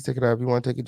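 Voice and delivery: ordinary talk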